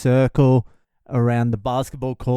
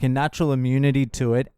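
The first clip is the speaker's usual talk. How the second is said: ordinary talk, nothing marked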